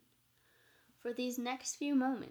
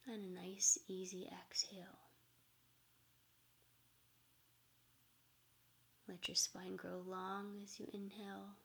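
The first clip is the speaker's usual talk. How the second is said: soft spa whisper; 5 dB quieter than usual. Acted. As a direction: ease them into calm breathing. Leads a meditation.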